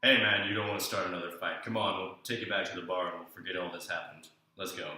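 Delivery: low-pitched big man's voice; huge guy calming a friend. Retold or imitated. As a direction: talk a friend down in a deep, imitated voice.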